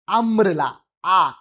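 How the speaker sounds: "আমব্রেলা" opened with a clear 'aa' sound, not 'yu'; "আমব্রেলা" starting on an open 'aa', then "আ" by itself; vowel sound demonstrated. Shown as a demonstration. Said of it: "ইউর মতো উচ্চারণ হচ্ছে না".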